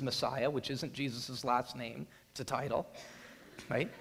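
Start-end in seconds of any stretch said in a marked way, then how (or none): none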